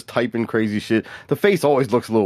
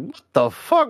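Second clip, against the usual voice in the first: high, retold what the fuck; shocked quote; 10 semitones higher than usual. Learning Rick is Australian, a retold high shocked outburst. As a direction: replay the shock of hearing him. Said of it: "I was like".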